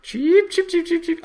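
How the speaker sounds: high squeaky chirps; cartoon bird voice. Imitating a bird.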